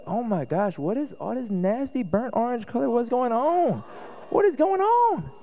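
hammed-up disbelief at the burnt orange house; mock shock. Pastor pretending to walk into a Longhorn fan's house, mock shocked.

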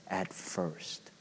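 "at first" said softly, pressed and slow; stressed at first. Shown as a demonstration. Preacher to congregation, "at first" pressed softly. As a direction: lean on "at first" quietly. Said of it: "the key word there is".

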